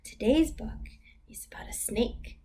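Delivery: ordinary talk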